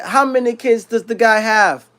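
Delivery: mock the boss in a rough Yiddish accent. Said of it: "like a Yiddish kind of accent".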